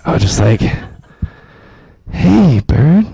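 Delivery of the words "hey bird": high, gentle call to a bird; cooing to a bird. Acted. Comic talking to a bird on a branch, high gentle coo. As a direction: coax the bird like a pet.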